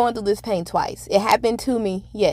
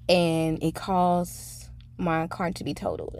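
normal talking voice

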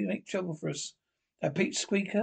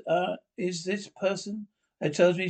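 ordinary delivery

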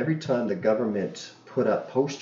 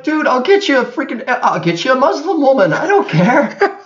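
high, loud mock pleading; bargaining with the beggar, high and excited; pleading offer; 11 semitones higher, 7 dB louder than usual. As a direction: beg him, half laughing. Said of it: "I was like".

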